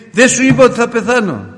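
very high, loud scolding cry; furious mother. Retold or imitated. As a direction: scold him for disobeying. (η μάνα φωνάζει θυμωμένα στον γιο)

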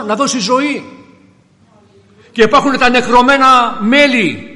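loud, high-pitched sermon delivery, building in force; impassioned preaching. Real, not acted. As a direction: preach it out, rising to a ringing proclamation.

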